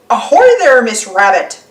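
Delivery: loud hail across water; calling ahoy. Acted. Captain Dog hails Miss Rabbit, loud and cheerful. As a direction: hail her from a distance.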